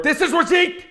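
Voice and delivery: put-on very high, hammy Indian accent; a bad Indian accent. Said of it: "He's so bad at it".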